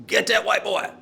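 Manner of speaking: high-pitched imitation of a kid yelling out; mock holler, pitched well up; retold yell. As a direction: call it out like an older kid across a playground. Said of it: "one of 'em yells out".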